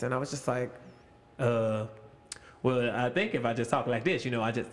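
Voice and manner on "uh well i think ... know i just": lightly voiced, lower cartoon Donkey. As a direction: slip lower into a hint of the Donkey character. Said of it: "started talking like Donkey".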